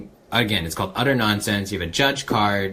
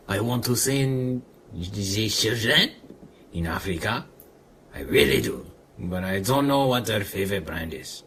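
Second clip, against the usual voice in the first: put-on French accent; 3 semitones lower than usual. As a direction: lay on a thick French accent, haltingly.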